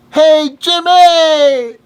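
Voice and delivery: loud, high, drawn-out shout; calling out. Retold or imitated. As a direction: imitate hailing a friend across a noisy club. Uncle calls across club to Jimi.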